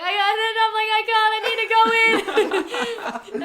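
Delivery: high, loud and slow, half laughing; panicked pleading. Retold or imitated. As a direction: beg to go in, terrified. Retold panic to dad.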